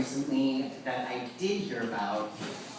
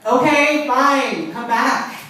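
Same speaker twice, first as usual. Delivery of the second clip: exasperated; 8 semitones higher, 9 dB louder, much slower than usual. Retold or imitated. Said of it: "exasperated mother".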